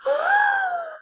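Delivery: very high dramatic gasp; big mock gasp. Storytime teacher gasping in hammed-up shock. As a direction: gasp like the page bit you. Surprised at the purple snake.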